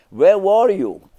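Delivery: high, loud, slow demanding wife; wife's sharp demand. Retold or imitated. Wife demanding where her husband has been, high and loud. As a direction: call him out as the suspicious wife. Wife confronts him coming home late.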